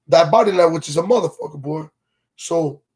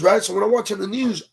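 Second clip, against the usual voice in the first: normal talking voice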